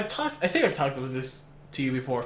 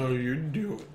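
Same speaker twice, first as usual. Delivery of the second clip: low, soft seductive voice; sultry narrator; 4 semitones lower, 6 dB quieter than usual. Pretend. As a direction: purr the question low. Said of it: "this sultry, like, voice".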